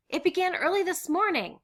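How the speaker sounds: high, loud, elated report; excited. Acted. Miep answering the household, excited. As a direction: deliver the news bursting with joy.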